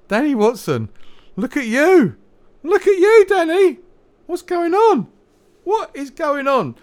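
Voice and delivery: high, loud mock holler; excited calling out. Mock calling out to Danny Watson.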